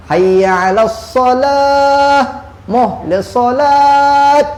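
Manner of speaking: high, slow, stretched call to prayer; chanted summons to prayer; muezzin's call. Retold or imitated. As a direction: sing it out like the village bilal straining. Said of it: "kata Tok Bilal".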